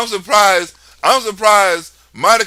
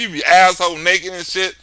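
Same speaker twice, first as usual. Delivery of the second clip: ordinary talk, nothing marked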